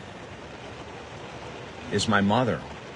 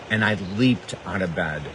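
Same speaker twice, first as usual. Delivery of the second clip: ordinary talk, nothing marked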